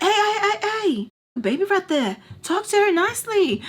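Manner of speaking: high-pitched mock reprimand; imitated scolding. Man defends the baby from her, imitated high scolding. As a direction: cut in with a high, imitated telling-off. Acted scolding of a quarrelling woman.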